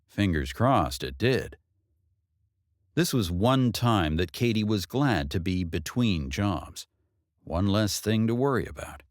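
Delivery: usual speaking voice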